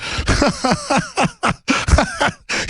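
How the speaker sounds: high, staccato mock cackle; mocking fake laugh. Imitating comedy show attacking him, fake laughter.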